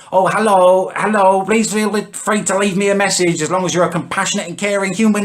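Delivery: high, loud, sing-song sweet hippie greeting; hammed-up fake-friendly answerphone voice; mocking sister's voicemail. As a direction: send up her saccharine voicemail message. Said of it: "she's got the most annoying voicemail".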